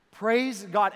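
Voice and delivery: preacher calling out with joy; loud praise. Real, not acted. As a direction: shout it out to the crowd. Rousing praise to crowd.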